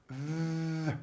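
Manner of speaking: "th" held as a soft, vibrating buzz; voiced th. Shown as a demonstration. Teacher demonstrates the sound "th", voiced and softly buzzed. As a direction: hum "th" with vocal cords vibrating.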